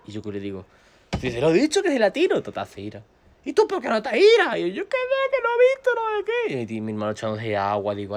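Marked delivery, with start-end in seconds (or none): 1.2-2.4 high, retold self-defence; loud protest. Defends himself to friend. 3.4-6.7 very high, loud mock bickering; shouted quarrel. Acted quarrel after the hit.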